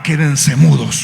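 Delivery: low, slow order; commanding. Retold or imitated. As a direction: silence the storm with measured authority. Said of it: "y ordenó al viento y a la mar".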